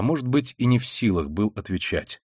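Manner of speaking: ordinary delivery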